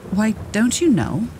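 put-on high, astonished little girl; surprised she has to ask; in surprise. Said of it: "she returned in surprise".

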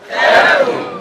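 congregation calling out heaven; crowd shout. Real, not acted. Congregation answers the preacher, shouting heaven. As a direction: call heaven back together.

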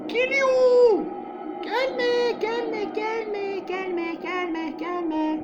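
çok tiz, panik içinde yalvarış; dehşetle çığlık atarak yalvar; panik çığlığı. Real, not acted. Nun approaching, panicking. (rahibe gelirken tiz panik çığlığı)

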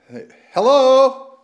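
loud, high call into the empty store; calling down a hallway. Real, not acted. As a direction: shout out to find someone in back.

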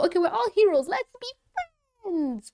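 high mock-happy friendship line; mock cheery heroes. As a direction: chirp it like a naive cartoon hero. Mocking all the heroes befriending.

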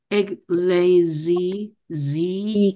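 ecclesi- stretched, then z- isolated on its own; slow z demo. Shown as a demonstration. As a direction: draw ecclesi- out and pull the z- apart. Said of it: "你看这个字放大".

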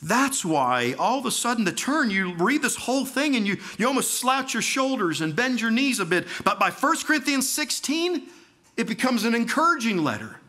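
high, loud, impassioned testimony; preaching at full force. Acted. Preacher testifying to the congregation about leaving Allah, impassioned. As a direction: pour it out, climbing with each question. Builds to turn in the letter.

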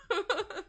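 short high-pitched giggle. Real, not acted. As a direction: burst into a quick giggle. Laughing at own assumption.

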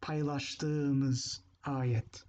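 Paylaştığımız ayet stretched out very slowly; teacher modeling Paylaştığımız ayet slowly; slow model phrase. Shown as a demonstration. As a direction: read Paylaştığımız ayet at a crawl for learners. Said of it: "this can either be past or present tense".

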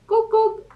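a very high "Cuc, cuc"; hen clucking. Acted. Hen answering Duck with high clucks. Animal noises in picture book.

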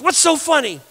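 high, indignant challenge; mock indignant. Woman confronts the man laughing at her, mock indignant. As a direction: imitate someone demanding an answer.

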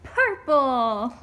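loud, big call. Acted. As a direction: belt the color out playfully. Parent calls a color out loud.

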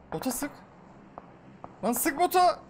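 impatient yelled order to a teammate; urgent yell. Real, not acted. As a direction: bark the order to shoot now. Urging teammate to shoot bot.

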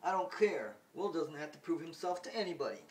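defiant mother. Retold or imitated. Mother defends Will, loud and high. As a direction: push back hard. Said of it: "Marine says".

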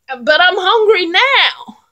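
high, loud, slow whining troll; troll's whine. Acted. Troll whining to the goat, high and loud.

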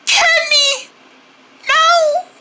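very high-pitched calling; desperate cry. Acted. Calling after departing friend.

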